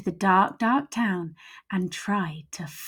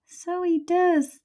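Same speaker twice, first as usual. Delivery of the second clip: much higher, slow agreeing voice; little skeleton; 10 semitones higher, slower than usual. Acted. Little skeleton agrees with big skeleton, high and slow. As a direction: pipe it slowly.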